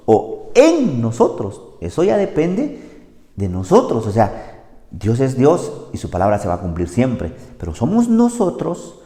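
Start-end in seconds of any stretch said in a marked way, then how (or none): none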